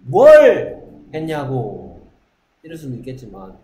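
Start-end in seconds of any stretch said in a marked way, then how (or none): 0.0-2.0 emphatic. Shown as a demonstration. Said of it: "강조하고 싶으면".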